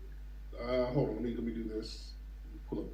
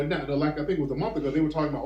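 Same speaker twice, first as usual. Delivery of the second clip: normal talking voice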